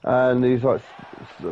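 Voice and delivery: ordinary delivery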